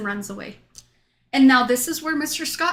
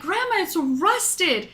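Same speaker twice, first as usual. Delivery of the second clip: higher, drawn-out exclamation of discovery; excited exclamation; 7 semitones higher, much slower than usual. Retold or imitated. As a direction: announce it high and slow, finally cracked it. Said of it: "I was finally like".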